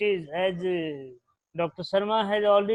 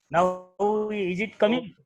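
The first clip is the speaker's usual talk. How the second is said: normal talking voice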